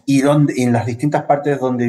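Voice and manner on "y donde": ordinary talk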